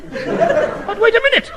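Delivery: high, slow squeak of realisation; sudden squeak. Acted. The minister squeaks as a thought strikes him.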